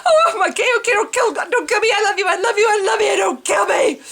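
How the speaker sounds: high, loud, panicked begging with a laughing cry; terrified pleading. Retold or imitated. Held at gunpoint, begging the gunman in high panic. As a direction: beg for your life, voice cracking. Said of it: "I'm scared to death".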